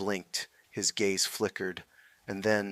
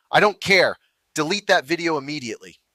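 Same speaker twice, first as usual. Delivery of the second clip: loud, high, fast command; relentless officer; 6 semitones higher, 8 dB louder, faster than usual. A narrator's reading. Officer refuses to listen and orders deletion, loud fast command. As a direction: brush him off and order it. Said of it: "The officer was relentless".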